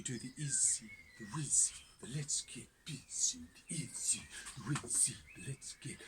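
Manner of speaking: hushed, secretive magician's spell; magician's mumbled incantation; whispered magic words. Acted. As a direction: whisper the spell over the coin. Magic words before the trick.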